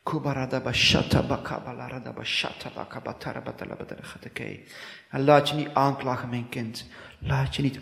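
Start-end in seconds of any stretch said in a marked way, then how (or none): 0.0-4.6 fast flowing tongues prayer, unlike his own voice; speaking in tongues. Real, not acted. Preacher praying over listeners, speaking in tongues fast. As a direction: let strange syllables pour out quickly in one stream.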